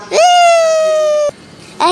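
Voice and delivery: long, high, loud woo; gleeful whoop. Pretend. Cheering while riding the trolley.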